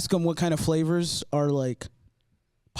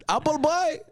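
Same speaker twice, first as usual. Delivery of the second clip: put-on higher Arabic-accented clerk naming a flavor; 8 semitones higher than usual. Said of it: "in that voice".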